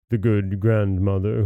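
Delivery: usual speaking voice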